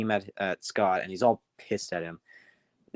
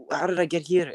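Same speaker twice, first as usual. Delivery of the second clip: higher, bewildered question; lightly acted puzzlement; confused; 7 semitones higher than usual. Lost engine asking how he got here, confused. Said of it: "And he's all confused".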